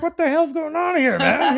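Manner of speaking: high, loud shocked outburst through laughter; mock stunned, can't believe what just happened; retelling the shock of seeing Johnny's suplex, laughing; amazed disbelief. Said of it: "I'm just like".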